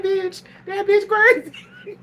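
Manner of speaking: laughing outburst. Retold or imitated. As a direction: shriek it out mid-laugh in disbelief. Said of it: "I said".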